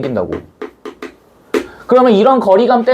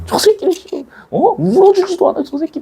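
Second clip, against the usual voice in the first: high, outraged audience grumble; mock indignant audience; 11 semitones higher than usual. Teacher voicing the audience, mock indignant at the bystander. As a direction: play a viewer fuming at a dry-eyed bystander. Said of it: "막 하겠죠".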